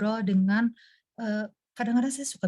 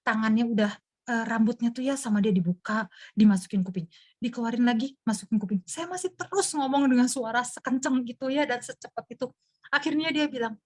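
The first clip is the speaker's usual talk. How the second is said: ordinary talk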